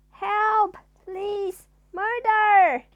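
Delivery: very loud, high cry for help; hammed-up panic scream. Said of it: "he screamed".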